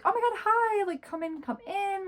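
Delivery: high-pitched, bubbly mock welcome; gushing greeting. Gushing welcome to arriving roommate.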